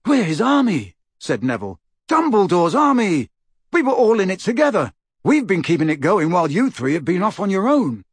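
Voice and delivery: high, forceful appeal; indignant Neville. Acted. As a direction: protest with rising heat. Voices Neville protesting.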